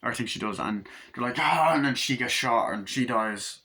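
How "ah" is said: loud, higher acted yell; short mock battle cry; attack yell.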